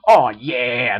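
loud joyful whoop; a loud happy cheer; joyfully. A narrator's reading. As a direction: cheer it with delight. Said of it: "Nova said joyfully".

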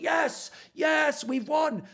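high excited shout of victory; mock celebrating. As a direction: cheer like your team just won. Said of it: "and I was like".

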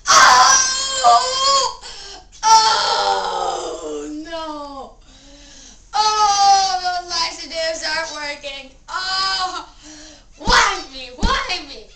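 loud, high hammed-up crying; over-the-top pretend sobbing; mock wailing. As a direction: wail it like a dramatic little tragedy. Pretend constipation agony.

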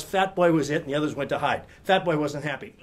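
ordinary delivery

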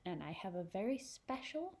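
ordinary delivery

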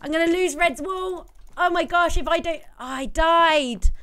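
high panicky gasps while fleeing; rattled and rushing, under pressure; being chased. Real, not acted. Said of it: "I think I'm being chased at the moment".

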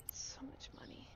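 soft defeated sigh; hushed dismay over money; whispered despair. Real, not acted. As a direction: sigh it out quietly.

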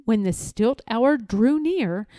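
ordinary delivery, nothing marked

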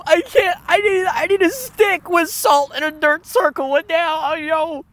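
giddy laughing reaction; excited. Real, not acted. Reacting excitedly to old video.

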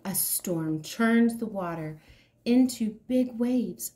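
ordinary delivery, nothing marked

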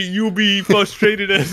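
slow, laughing rapper drawl. Retold or imitated. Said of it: "talking like fucking [name]".

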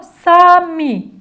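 put-on teacher reading the word aloud to children. Shown as a demonstration. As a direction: announce "Sami" clearly for the class to repeat. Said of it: "Nasıl okunuyor?".

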